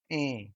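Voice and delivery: E as a nasal vowel with no closing N; E nasalised the Portuguese way. Shown as a demonstration. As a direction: hold E through the nose. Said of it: "in Portuguese it sounds".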